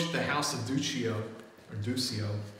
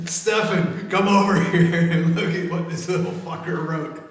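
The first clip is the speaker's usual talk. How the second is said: higher, louder, laughing as he calls; guard cackling to his buddy about the forgery; 6 semitones higher, 9 dB louder than usual. Acted. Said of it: "He's like".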